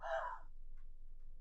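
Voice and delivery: sharp breathy gasp, imitating dad; sudden voiceless gasp. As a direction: act out a shocked intake of breath. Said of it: "what was that word called when people do that? Gasp".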